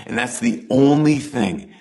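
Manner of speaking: ordinary delivery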